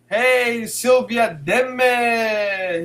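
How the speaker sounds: usual speaking voice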